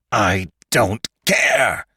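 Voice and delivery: slow, dragged-out scream; a stretched, desperate yell; Lantry screaming back at McClure mid-struggle. A narrator's reading. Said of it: "screamed Lantry".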